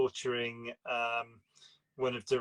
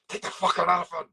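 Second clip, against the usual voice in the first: loud, higher-pitched movie-quote yell; mock scream; 5 semitones higher, 7 dB louder, faster than usual. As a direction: imitate a film character screaming an order. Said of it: "they all end up screaming".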